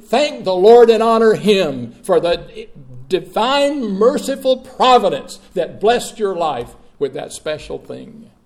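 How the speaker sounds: loud, urgent exhortation; full-force preaching. Acted. Preacher urging the congregation to thank God, loud exhortation. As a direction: press it on the congregation.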